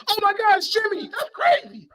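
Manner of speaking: high, loud thrilled outburst; excited shout. Retold or imitated. Wrestling fan reliving Jimmy's entrance, excited shout. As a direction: shriek it like a fan seeing Jimmy. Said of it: "I genuinely was like".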